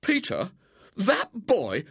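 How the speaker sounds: high, loud indignant yelps; boy's affronted cry, pitched high and loud; outraged disbelief. Acted. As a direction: spit out each word in outraged disbelief. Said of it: "William was outraged".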